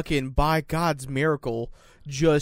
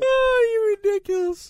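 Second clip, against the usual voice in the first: very high squeal of fond disbelief; high-pitched teasing squeal; 4 dB louder than usual. Real, not acted. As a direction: squeak it out, much higher. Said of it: "I'm not ridiculous".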